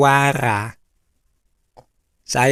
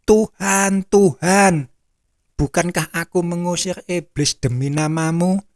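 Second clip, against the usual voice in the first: high-pitched appeal, only mildly raised volume; mock crying out; 5 semitones higher than usual. Rejected believer appeals to the Lord, acted crying out. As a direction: call up to the Lord in protest. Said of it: "orang yang teriak-teriak".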